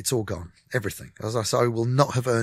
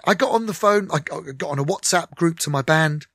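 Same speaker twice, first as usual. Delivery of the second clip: ordinary talk, nothing marked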